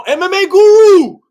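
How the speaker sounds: high, loud calling out; shouting the name at the screen; excited shout. Real, not acted. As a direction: yell the name in delight.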